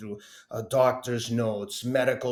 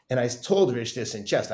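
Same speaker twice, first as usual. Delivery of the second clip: ordinary talk, nothing marked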